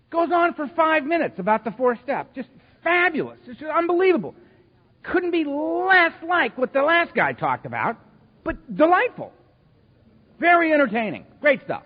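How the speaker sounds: sarcastic praise. Retold or imitated. Sarcastic praise of long shares.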